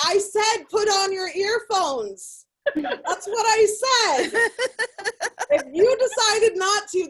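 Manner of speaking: loud, slow, high-pitched telling-off; mock scolding. Mom telling off teenage carpool passengers, indignant scolding. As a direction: lay down the law, stretching each phrase. Acted scolding of son's friends.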